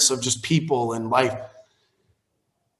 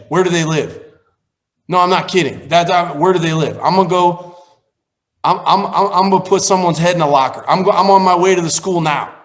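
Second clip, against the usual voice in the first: loud, higher, heated rant; retold rage; 4 semitones higher, 5 dB louder than usual. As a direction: relive the fury of a father ready to fight. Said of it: "I regressed to an eighth grader in the moment, emotionally".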